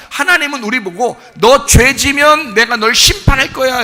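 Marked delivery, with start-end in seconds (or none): 1.4-3.8 raised, slow warning; God threatening judgment. Acted. God warning a sinner of judgment, stern. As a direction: pronounce it as a looming verdict. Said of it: "이렇게 다가오는 게 아니고".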